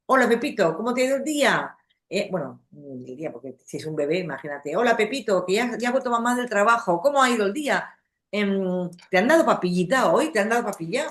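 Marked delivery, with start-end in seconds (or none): 0.1-1.7 louder, higher mock grown-up greeting; adult talk to baby. Shown as a demonstration. Mother greeting her baby, stiff adult voice. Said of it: "no le decimos". 4.7-11.1 put-on higher, louder sing-song baby talk, too tidy. Shown as a demonstration. Mother home from work cooing at baby, too proper. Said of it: "lo estoy diciendo demasiado bien".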